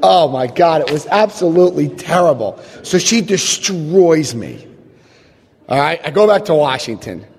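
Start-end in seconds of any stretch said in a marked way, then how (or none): none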